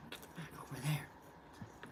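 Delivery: hushed whisper to the dog. Real, not acted. Host shoos his dog off camera, whispered. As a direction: whisper it so the stream can't hear.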